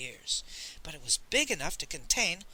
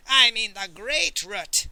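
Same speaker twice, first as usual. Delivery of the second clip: put-on slow, booming accented spectre; ghostly roar; 4 semitones higher, 8 dB louder, slower than usual. A narrator's reading. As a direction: roar it out slowly, like an angry spirit. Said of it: "roared the patron of ginseng".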